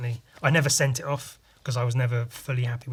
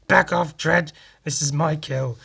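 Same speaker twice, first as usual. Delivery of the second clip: defiant shout; 2 semitones higher, 6 dB louder than usual. Retold or imitated. Judge defying Dredd.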